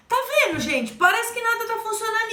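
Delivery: high, exasperated mock complaint; frustrated patient. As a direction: whine to everyone that nothing works. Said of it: "Fala assim".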